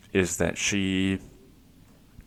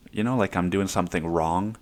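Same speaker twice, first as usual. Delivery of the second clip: ordinary talk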